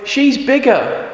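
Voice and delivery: put-on high, slowish small boy. Boy blaming the bigger girl, high and slow. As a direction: tattle back, high. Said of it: "says the boy".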